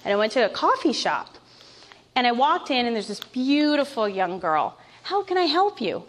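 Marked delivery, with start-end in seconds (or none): 5.0-6.0 cheerful barista. Retold or imitated. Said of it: "she says".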